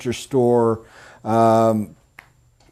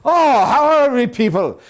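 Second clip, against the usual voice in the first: much higher, loud, a hammed-up Irish salesman's greeting; over-the-top Irish accent greeting, louder on the opening; mock Irish accent; 11 semitones higher, 3 dB louder than usual. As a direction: ham up an Irish accent to match the customer. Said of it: "went with the accent".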